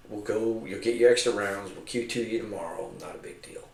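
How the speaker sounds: soft, understated retold reassurance; low-key commander. Commander telling gunner about tomorrow, soft and low-key. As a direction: play it down, very quietly. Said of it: "he said".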